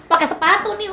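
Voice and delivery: high, loud hammed-up threat with a shoe; mock angry threat. Parent threatening child with a shoe, hammed-up yell. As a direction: shout it like a parent about to hit. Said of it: "Nggak perlu".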